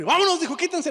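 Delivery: a director barking orders; commanding order. Retold or imitated. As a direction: clear them out.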